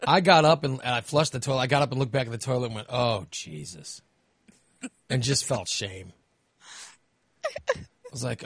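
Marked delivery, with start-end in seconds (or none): 2.9-4.0 dismayed groan. Retold or imitated. Looking back at the toilet.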